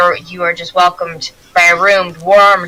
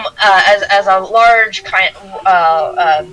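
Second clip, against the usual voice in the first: ordinary talk, nothing marked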